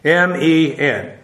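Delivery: M E N spelt letter by letter, slowly; spelling out. Shown as a demonstration. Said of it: "this conjunction men".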